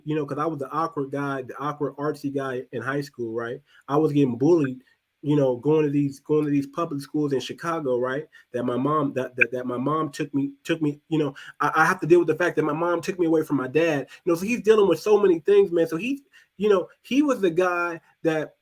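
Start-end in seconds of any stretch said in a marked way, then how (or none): none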